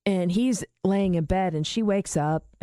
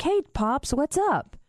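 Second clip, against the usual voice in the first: high cheery greeting; 7 semitones higher than usual. Retold or imitated. Cheerful greeting to stoned dad.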